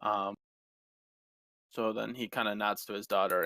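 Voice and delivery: ordinary talk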